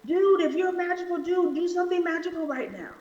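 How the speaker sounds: high, fast jeering dare; taunting criminal. Retold or imitated. Preacher voices the criminal daring Jesus, high and jeering. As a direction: dare him to do magic. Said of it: "It's like".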